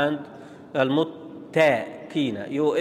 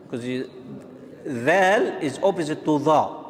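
ordinary talk, nothing marked

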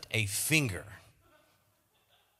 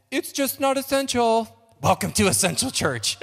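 stewardess voice; 6 semitones higher, 4 dB louder than usual. Pretend. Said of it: "by talking in my stewardess voice".

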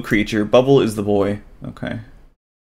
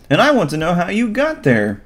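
put-on a bit higher boyish voice; lightly voiced boy; 8 semitones higher than usual. Bubble answers the creature, lightly boyish. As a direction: play the boy a notch up.

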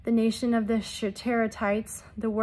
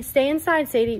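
loud, raised command to a pet; calling out an order; 4 semitones higher, 8 dB louder than usual. Real, not acted. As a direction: call it out firmly over the room. Breaks off to order pet inside.

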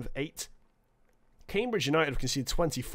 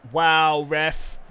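loud, stunned cry at the ref; a real burst of outrage at the foul; sudden loud outburst; outraged shout; 8 dB louder than usual. Real, not acted.

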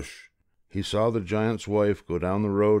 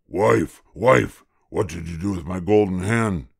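loud bellowing giant; giant shouting; 4 dB louder than usual. A narrator's reading. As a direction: bellow for the wife. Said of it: "Jack heard the giant call".

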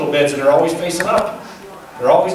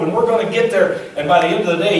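ordinary talk, nothing marked

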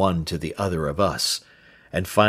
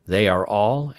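usual speaking voice

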